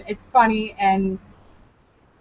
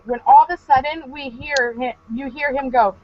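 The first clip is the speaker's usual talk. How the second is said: usual speaking voice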